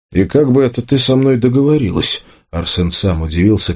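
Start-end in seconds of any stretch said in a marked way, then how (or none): none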